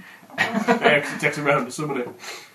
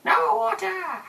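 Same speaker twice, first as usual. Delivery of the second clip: screaming at a spider; 5 dB louder than usual. Acted. GM yells high over table.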